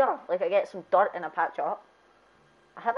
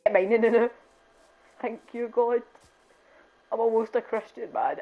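ordinary talk, nothing marked

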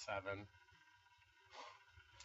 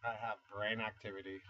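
ordinary talk, nothing marked

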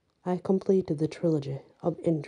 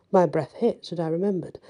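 ordinary delivery, nothing marked

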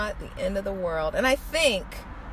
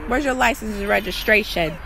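usual speaking voice

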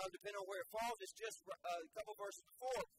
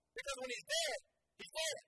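emphatic; 12 semitones higher, 3 dB louder than usual. Real, not acted. Preacher building emphasis.

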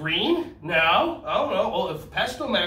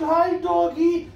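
put-on high, slow baby-talk to a puppy; cooing at a dog; 11 semitones higher, 5 dB louder, slower than usual. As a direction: coo at the cute little dog. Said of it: "And I said".

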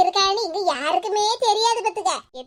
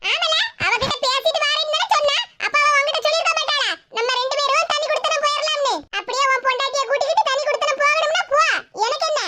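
higher put-on voice, scornful and dismissive; scornful mother; 6 semitones higher than usual. Mother refusing her son any share of the property, higher scornful voice. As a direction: brush him off like a spiteful mother.

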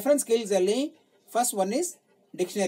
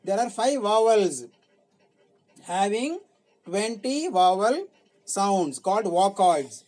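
usual speaking voice

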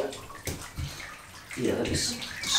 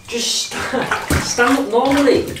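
high, loud exasperated plea; exasperated, begging the dog to stand; 10 semitones higher, 5 dB louder, much slower than usual. Real, not acted.

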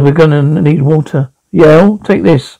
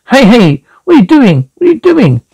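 alarmed cry; 6 semitones higher than usual. Acted. Panic as the pod launches.

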